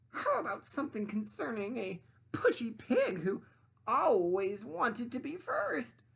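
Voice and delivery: high, loud demanding sandwich voice; high bossy sandwich lady voice. Acted. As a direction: play the pushy sandwich, high and grand. Sandwich's sly suggestion.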